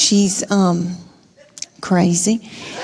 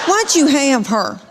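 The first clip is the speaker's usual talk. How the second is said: indignant daughter; 4 semitones higher than usual. Retold or imitated. Acts daughter's angry complaint about sibling.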